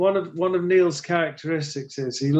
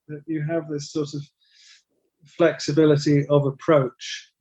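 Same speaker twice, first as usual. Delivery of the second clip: ordinary talk, nothing marked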